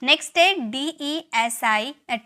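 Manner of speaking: ordinary talk, nothing marked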